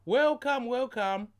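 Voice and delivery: high, lilting welcome welcome; sing-song welcome. Acted. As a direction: sing the greeting out. Repeats welcome with tune.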